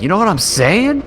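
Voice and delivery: squeaky high-pitched quip; high mock voice. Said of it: "I look over and I'm like".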